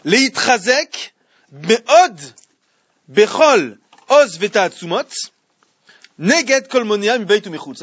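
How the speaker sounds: slow, loud chanted reading of the Hebrew; Hebrew text recited. Acted. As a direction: intone the verse slowly like a study chant. Lit un texte hébreu. (lecture solennelle et lente du texte hébreu)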